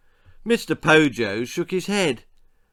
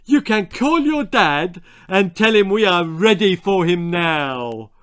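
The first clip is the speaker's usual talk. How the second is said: a hint of a pompous villager, higher; proud little man, a touch puffed up; lightly voiced Mister Cluck; 6 semitones higher, 7 dB louder than usual. A narrator's reading. Mister Cluck boasts to the messenger, lightly voiced.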